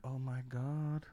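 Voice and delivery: mock dread. Retold shock at seat count.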